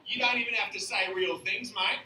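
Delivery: put-on Aussie accent; hammed-up party bloke with an Australian accent. Comic coaching men at parties, Australian accent. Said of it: "saying some really offensive things with an accent".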